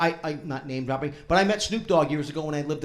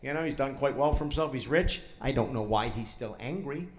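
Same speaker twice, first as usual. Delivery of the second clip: ordinary talk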